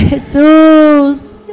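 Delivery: ordinary talk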